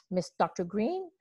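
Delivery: usual speaking voice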